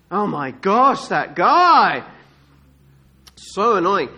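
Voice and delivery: loud exasperated complaint; hammed-up irritation; annoyed bystanders. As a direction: groan loudly about that pest. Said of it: "they tell him to shut up".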